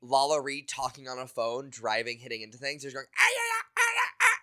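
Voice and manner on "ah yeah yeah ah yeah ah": squeaky high mumbled nonsense; high-pitched gibberish. Retold or imitated. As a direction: babble high and silly like a cartoon alien. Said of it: "mumbling in high-pitched gibberish".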